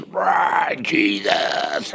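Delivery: imitating a wrestler-style preacher's shout; mock wrestler roar. Describing the WWE preacher to a friend, mock wrestler roar. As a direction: roar it like a ring announcer. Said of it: "This guy is W W E".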